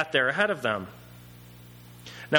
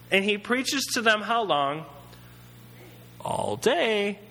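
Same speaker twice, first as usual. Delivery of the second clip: ordinary talk, nothing marked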